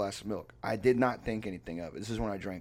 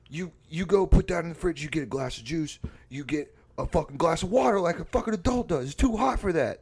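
high, louder bossy rant; imitated angry scolding; 7 semitones higher, 6 dB louder than usual. Roommate orders friend to drink water instead, imitated angry scolding. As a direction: lay down the law in mock outrage. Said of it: "he's tearing into my ass with my drink choice".

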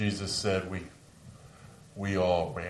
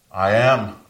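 bold declaration; 15 dB louder than usual. Acted. Jesus answers the arresting crowd.

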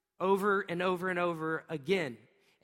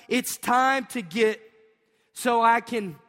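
slow and louder, rallying the crowd; louder repeat; 3 semitones higher, 6 dB louder, slower than usual. Shown as a demonstration. Pastor urging the congregation to say it louder and slower. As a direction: push it up for the second round. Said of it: "Would you say it one more time?".

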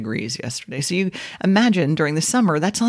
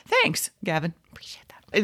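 whispered mock thanks; whispered sarcastic aside; 6 dB quieter than usual. Interviewer to teasing singer live onstage, whispered sarcastic aside. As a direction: drop to a whisper, as if under your breath.

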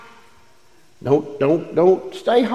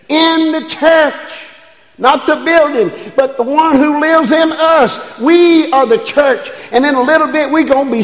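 full-force preaching; 11 semitones higher, 5 dB louder than usual. Acted. Pastor preaching to the congregation about the church. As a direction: ring it out from the pulpit.

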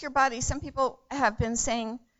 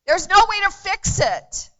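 louder, higher anguished preacher's cry; impassioned cry; 8 semitones higher, 13 dB louder than usual. Real, not acted. Preacher crying out to the congregation about his sister's loss.

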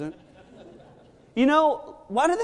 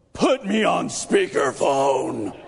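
livid; 4 dB louder, slower than usual. Retold or imitated. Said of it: "I was so livid".

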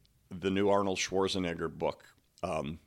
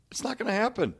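high-pitched dismissal; 13 semitones higher, faster than usual. Real, not acted. Coach on perfectionism to listeners, high-pitched dismissal. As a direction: voice jumps way up high.

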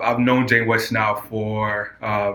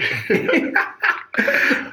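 high airy laugh. Acted. Host laughing mid-intro.

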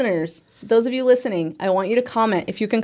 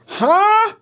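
imitating his huh; 11 semitones higher, 3 dB louder than usual. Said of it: "So loud when he can't".

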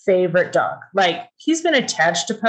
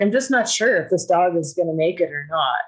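normal talking voice

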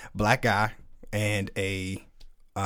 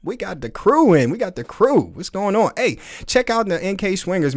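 higher, louder, eager hellos; excited greeting; 8 semitones higher, 5 dB louder than usual. Real, not acted. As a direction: call out to the new arrivals with delight. Host excitedly greets incoming chatters.

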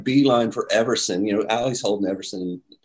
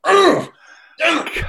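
high, loud mock "ugh, damn it"; a missed chance, voiced as a pitched-up groan; frustrated groan; 5 dB louder, slower than usual. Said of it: "I'm like".

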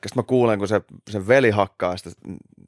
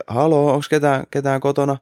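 higher, calling into an empty flat; 6 semitones higher than usual. Retold or imitated. Friend at the door calling into the flat, mild call-out. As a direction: call out carefully to check if anyone's home. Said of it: "se huikkaa silleen".

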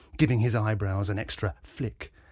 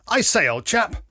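put-on loud, higher-pitched old-chap interjection; blustering Major; 11 semitones higher, 7 dB louder than usual. A narrator's reading. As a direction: cut in as a blustering military man. The Major cuts in, acted.

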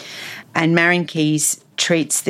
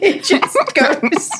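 laughing, pitched way up; laughing through the words; 12 semitones higher, much slower than usual. Real, not acted. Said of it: "laughing together".

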